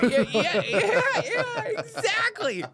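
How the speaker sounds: very high, squealed agreement; real high-pitched glee, cracking up; delighted agreement. Real, not acted. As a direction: agree excitedly through rising laughter. Delighted agreement amid shared laughter.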